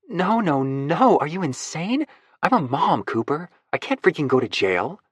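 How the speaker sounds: panicked Lucy. Acted. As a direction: refuse in a flustered rush. Narrator voices panicked Lucy.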